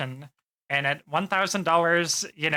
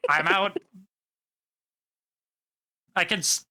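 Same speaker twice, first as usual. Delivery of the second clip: ordinary talk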